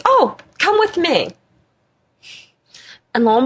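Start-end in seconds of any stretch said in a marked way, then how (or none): 0.1-1.3 high, slow, drawn-out discovery; relenting invitation. Retold or imitated. Innkeeper finally finding a room in his book, high drawn-out invitation. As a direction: lift it high and stretch it out.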